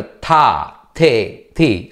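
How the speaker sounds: "థా, థే, థీ" with a heavy, aspirated th; pressed aspirated th. Shown as a demonstration. Teacher showing learners how to press the sounds, aspirated "థా, థే, థీ". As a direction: press firmly on the th in each of "థా, థే, థీ", never light. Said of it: "ఒత్తి పలకాలి".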